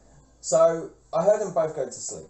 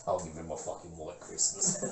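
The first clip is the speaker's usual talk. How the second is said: low menacing mutter; 10 semitones lower, 9 dB quieter than usual. Acted. Dark joke, low far voice.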